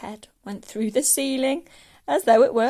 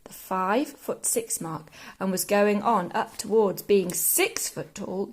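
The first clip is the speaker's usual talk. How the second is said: usual speaking voice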